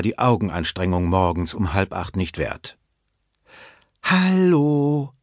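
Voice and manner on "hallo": hohes, lang gezogenes Hallo; aufdringliche Frau. A narrator's reading. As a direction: zieh den Gruß hoch und fordernd in die Länge. Frau ruft erneut, wird ignoriert. (gedehnter, hoher Frauenruf)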